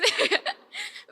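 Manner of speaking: high, loud burst of laughter; sudden high-pitched laugh. Real, not acted. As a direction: burst out laughing after admitting it. Laughs at own admission.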